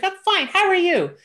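high, perky mock nurse reply; chipper nurse. Nurse chats at early blood test, chirpy high imitation. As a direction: chirp it like a too-cheerful nurse. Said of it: "really chipper nurse".

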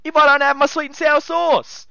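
high, loud outraged rant; riled up rant. Real, not acted. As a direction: pour outrage into every word. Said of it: "I'm getting real riled up here".

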